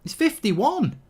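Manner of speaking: high-pitched astonished outburst; incredulous. Real, not acted. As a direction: voice shoots up in disbelief. Astonished at the referee's age.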